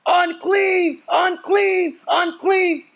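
shouting a warning down the street; leper's warning yell. Retold or imitated. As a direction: call out unclean so people keep away. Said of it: "they had to literally yell".

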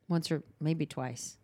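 ordinary talk, nothing marked

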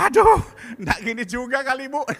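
high, loud aghast cry; shocked protest. Retold or imitated. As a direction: recoil at the sight. Pastor's shocked reaction. (pendeta kaget berseru keras)

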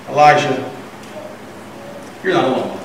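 low, measured, gentle reply; quiet, settled comfort; calm reassurance. Retold or imitated. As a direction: answer a whiner softly and patiently. God calmly answers whining Elijah.

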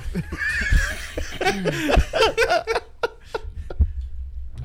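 high unvoiced wheezing laugh; breathless high-pitched cackle; long laugh. Real, not acted. Laughing at own story.

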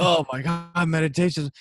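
high-pitched, mock-overwhelmed exclamation; mock drama. Meditation teacher mimicking a beginner's big dramatic reaction, mock drama. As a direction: gasp it out as a hammed-up revelation.

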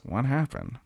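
lightly put-on drunkard's question; grungy writer voice. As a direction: softly imitate the scruffy writer. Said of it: "That's how he talks".

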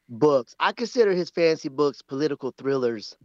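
usual speaking voice

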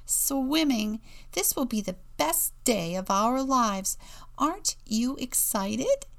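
high, emphatic burst of excitement; gushing. A narrator's reading. Excited penguin to her nervous classmate before swimming, gushing. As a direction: gush with big, lifted emphasis. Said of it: "Mary gushed".

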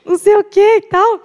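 high-pitched imitation of a mother on the phone; imitating worried mother. Mother phoning the boyfriend about her crying daughter, high mock worry. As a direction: retell mom's question way up high. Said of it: "minha mãe ligou pro Lucas e falou assim".

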